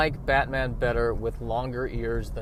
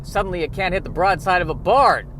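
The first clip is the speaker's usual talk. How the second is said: higher and louder scolding; aggravated Alfred; 5 semitones higher, 5 dB louder than usual. Pretend. Butler scolds Batman over the bathroom, higher and louder. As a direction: nag the boss about the mess. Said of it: "Alfred's aggravated".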